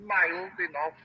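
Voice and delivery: put-on slow, grand, French-Russian tinged accent; a lordly despot in a muddled accent; fake Spanish accent. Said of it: "take French and Russian and slam it together".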